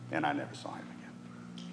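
whispered regret; quiet whisper of sorrow; hushed. Real, not acted. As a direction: breathe it out softly, sadly. Sad memory of a lost soul.

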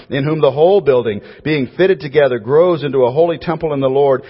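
loud, high preaching cadence; building up preaching fervor; fervent scripture reading. Acted. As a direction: proclaim the verse to the whole hall. Reads Ephesians at full force.

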